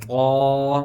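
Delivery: طا with a heavy t and held rounded vowel; loud, stretched, heavy طا; thick, heavy طا. Shown as a demonstration. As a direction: fill the mouth on طا. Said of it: "اوپر تا باریک ہے".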